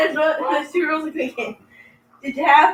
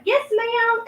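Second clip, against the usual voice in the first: drive-thru worker; 11 semitones higher than usual. Retold or imitated. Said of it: "she was like".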